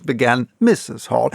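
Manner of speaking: ordinary delivery, nothing marked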